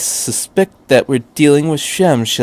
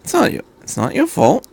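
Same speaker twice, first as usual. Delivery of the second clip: odd tone; 10 semitones higher than usual. A narrator's reading. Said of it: "the doctor said in an odd tone of voice".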